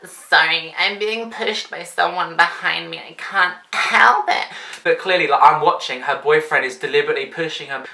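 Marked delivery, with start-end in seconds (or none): 0.1-4.5 slow, put-on innocent voice; mocking fake apology. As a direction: parody a whiny excuse. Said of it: "she's just like".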